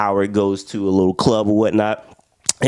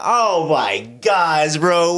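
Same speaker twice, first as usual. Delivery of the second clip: mock disgust; 4 semitones higher, much slower than usual. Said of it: "I'm like".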